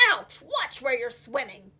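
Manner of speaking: grumpy puffer fish complaint; grumbling puffer fish. Acted. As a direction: gripe at a clumsy stranger. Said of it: "grumbled a voice".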